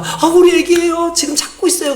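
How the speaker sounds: high-pitched worried mum, lightly acted; anxious mother. Mother tells a caller she is searching for her child, imitated anxious voice.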